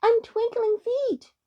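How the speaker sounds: high, slow, insistent little voice; crying pixie. A narrator's reading. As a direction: announce yourself in a high pixie cry. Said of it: "cried the pixie".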